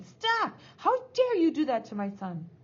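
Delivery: high, loud burst then indignant demand; fierce, protective outrage; outraged shout. A narrator's reading. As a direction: burst in shouting as a furious mother.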